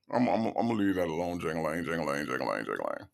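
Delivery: mock cowering Dre. Host mocking Dre dropping the argument, trailing low. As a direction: stammer into a low, soft nonsense chant. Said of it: "Dre sounded like Jangalang".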